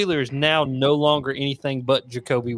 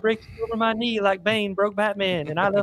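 meathead jock; 7 semitones higher than usual. Retold or imitated. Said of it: "He's like".

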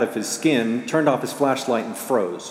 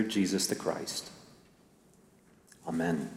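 prayer. Real, not acted. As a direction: lead the congregation gently in prayer. Pastor leads prayer.

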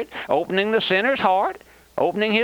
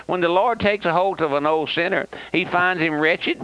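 usual speaking voice

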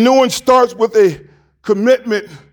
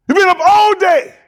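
preacher shout; 8 semitones higher than usual. Real, not acted. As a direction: throw it up to the back rows.

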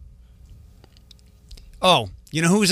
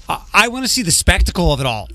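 ordinary talk

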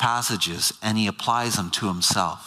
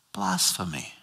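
ordinary talk

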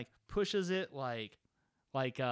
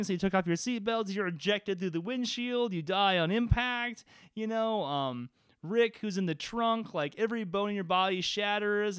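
raised, animated scene narration of the crash; game master narration; 4 semitones higher than usual. Acted. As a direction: narrate the fatal crash with relish.

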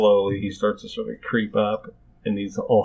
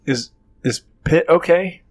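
nervous NPC voice; 9 semitones higher, 8 dB louder than usual. Acted.

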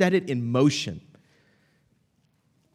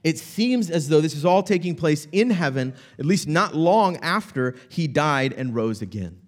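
ordinary talk

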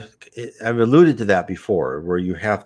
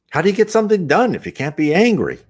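high, quick objection; challenging question; 12 semitones higher, faster than usual. Acted. Questioner challenging a spirit lecturer, protesting.

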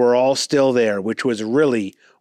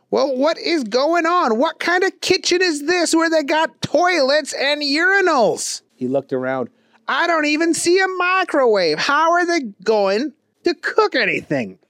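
high, puzzled goofy voice; bewildered simple fellow; Booger voice. A narrator's reading.

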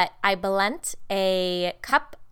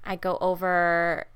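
ordinary delivery

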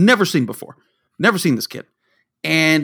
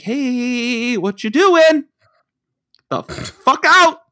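mock yell at kids; 7 semitones higher, much slower than usual. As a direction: chase the kids out, stretched. Yelling at stray kids.

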